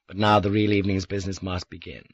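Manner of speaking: ordinary delivery, nothing marked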